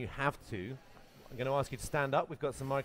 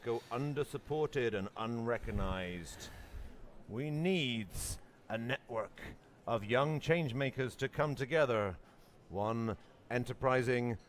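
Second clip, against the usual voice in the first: movie trailer voice; 2 semitones lower than usual. Shown as a demonstration. Host improvising a trailer for his project, movie trailer voice. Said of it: "I'm gonna make up the trailer".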